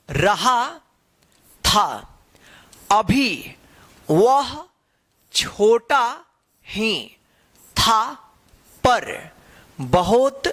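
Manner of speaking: slow story reading, one word at a time; reading to children. Acted. Teacher reads the story to children, slow and word by word. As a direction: read it out word by word so kids follow.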